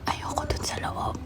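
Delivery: voiceless secret whisper. Real, not acted. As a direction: whisper it so nobody hears. Repeats line, then whispers it.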